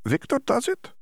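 explaining his fur sheepishly; foreign-accented Dimitri. A narrator's reading. Dimitri retorts.

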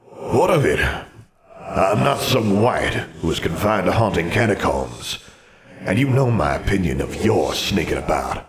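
echoing ghostly wizard voice; imperious spectre with a hollow reverb; haughty ghost. Acted. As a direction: dismiss it as a proud old phantom. Ghost answers haughtily, arms folded.

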